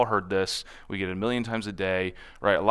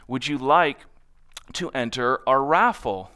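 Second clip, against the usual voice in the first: unhurried, slower question; slower pitch; 2 semitones higher than usual. Retold or imitated. Natasha using the simplified pitch, slower. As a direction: take your time and ask simply. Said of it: "And so then she changed it".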